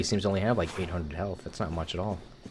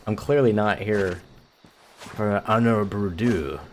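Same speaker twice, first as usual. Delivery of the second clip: loud, exaggerated mock Japanese accent; fake Japanese accent; 3 semitones higher, 4 dB louder, slower than usual. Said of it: "clearly exaggerated and clearly fake Japanese accent's".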